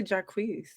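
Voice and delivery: "Jacquees" with a long EE ending; Jacquees said ja-KWEESE. Shown as a demonstration. Co-host citing the radio version, "Jacquees" as ja-KWEESE. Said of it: "Some people hear it on the radio".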